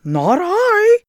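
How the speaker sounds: high squawking goose voice. A narrator's reading. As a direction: honk it high and silly. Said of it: "said the goose".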